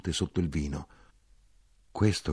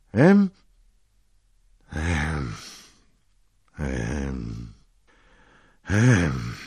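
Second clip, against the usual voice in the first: eerie graveside grunt from below the earth; a ghostly murmur rising from the grave; terrible voice; 4 semitones lower, 4 dB louder, much slower than usual. A narrator's reading. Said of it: "rispose la terribile voce".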